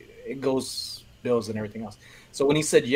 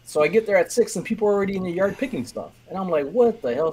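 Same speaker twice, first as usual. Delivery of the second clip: higher, building to a mock outburst; surprised; 7 semitones higher, 3 dB louder than usual. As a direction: retell the shock, rising up to the curse. Arriving early, finding crowd.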